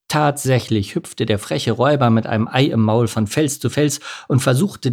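plain reading voice

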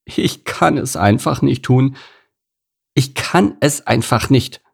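pitiful protest from a cow character; plaintive. A narrator's reading. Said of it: "stieß sie einen kläglichen Seufzer aus".

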